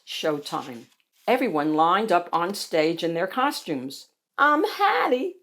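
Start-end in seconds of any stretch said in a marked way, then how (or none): 4.4-5.4 high little character voice; squeaky voice. A narrator's reading. Bonnie speaking up in costume line, squeaky voice. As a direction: squeak it out timidly. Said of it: "squeaked Bonnie Beverly".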